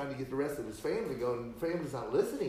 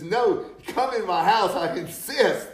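much louder and higher, laughing as he insists; insistent; 8 semitones higher, 12 dB louder, slower than usual. Retold or imitated. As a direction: insist hard, half laughing. Lot insists strangers come inside.

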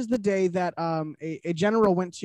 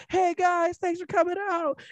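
much higher, mock concert greeting; hyped-up yelling imitation; 12 semitones higher than usual. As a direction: imitate an over-hyped singer calling out to fans. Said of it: "really hype and she'd be yelling".

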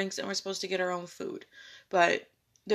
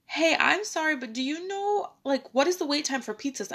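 higher-pitched, apologetic ask to a busy boss; apologetic request; 7 semitones higher, 4 dB louder than usual. Retold or imitated. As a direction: politely interrupt the boss with a question. Acts out asking busy owner.

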